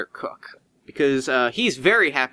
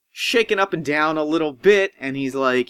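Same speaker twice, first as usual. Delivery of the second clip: usual speaking voice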